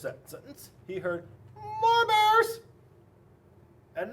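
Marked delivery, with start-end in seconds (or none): 1.5-2.6 put-on high, loud kids' shout; high-pitched holler of boys and girls; children yelling. A narrator's reading. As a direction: yell it like an eager crowd of kids. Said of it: "sounded just like boys and girls".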